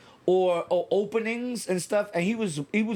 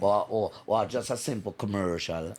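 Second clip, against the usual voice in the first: put-on Jamaican accent; 5 semitones lower than usual. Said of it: "in a Jamaican accent".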